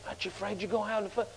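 hushed, very soft, hurried question; quiet. Retold or imitated. Worried man asking Brother Hagin about flu, quiet. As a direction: ask it fast under your breath. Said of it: "Said it quiet, you know".

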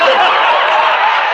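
big burst of laughter; a loud breathy laugh. Real, not acted. Laugh after grandpa's question.

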